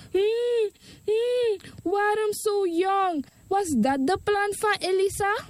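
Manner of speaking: high, slow crying mother; grieving mother crying over her dead son, high and tearful; mother weeping. Acted. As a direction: sob the words as a grieving mother. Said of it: "De moeder huilde".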